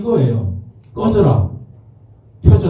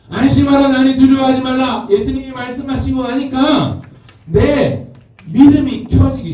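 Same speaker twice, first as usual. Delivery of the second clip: high, ringing preacher's voice; high-pitched sermon delivery; impassioned preaching; 6 semitones higher than usual. Acted. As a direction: proclaim it to the congregation in a raised pitch. Raised preaching voice.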